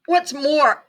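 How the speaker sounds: high, sharp creature snap; snapping Lorax. A narrator's reading. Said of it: "snapped the Lorax".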